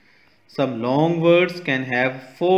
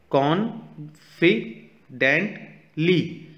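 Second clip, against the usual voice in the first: Confidently in four slow, separate beats, higher pitched; syllable by syllable; 4 semitones higher, much slower than usual. Shown as a demonstration. Teacher showing kids four syllables, confidently split syllable by syllable. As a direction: sound out confidently as कॉन, फि, dent, ली with pauses. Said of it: "यहाँ four syllables use किए गए हैं".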